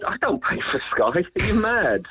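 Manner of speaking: normal talking voice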